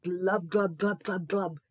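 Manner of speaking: fish glub. Acted. Imitating a fish.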